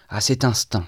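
ordinary delivery

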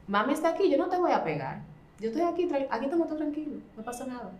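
put-on gently calm a child after a tantrum; reassuring. Acted mother calming child after tantrum.

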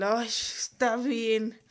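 very drawn-out, reluctant giving-in; grudging dad. Retold or imitated. Dad giving in about the stuffed toy, imitated grudging drawl. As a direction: stretch it out like a dad relenting.

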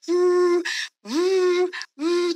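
long, high hooted "woo" like a horn; imitated horn blasts. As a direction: mimic warning horn blasts. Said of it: "you get the three blasts".

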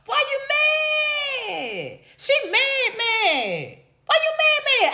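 high, sing-song mocking "why you mad"; mocking taunt. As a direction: tease her in a high, taunting voice. Taunting the commenter.

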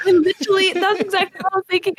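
high, quick, laughing words; laughing agreement. Real, not acted. Agreeing with co-host's clown car joke, laughing. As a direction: agree through real laughter, pitch right up.

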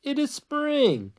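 high, drawn-out wake-up call; high-pitched storybook call. A narrator's reading. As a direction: sing it out high to rouse a sleeper. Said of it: "shouted Frog".

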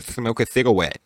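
put-on lisped toddler-style mispronunciation; baby talk. Shown as a demonstration. Demonstrating the voices' taunt, speaking like a baby. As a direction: say it like a baby, lisping the consonants. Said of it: "speaking like a baby".

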